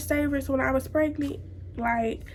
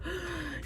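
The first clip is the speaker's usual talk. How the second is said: pain gasp; 6 semitones higher, 14 dB quieter than usual. Retold or imitated. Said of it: "So I'm like".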